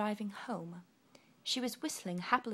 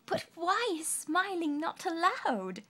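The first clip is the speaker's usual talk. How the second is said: high, slow amused ask; Little Miss Sunshine laughs at the rule; laughing question; 10 semitones higher, 6 dB louder than usual. Acted. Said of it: "laughed Little Miss Sunshine".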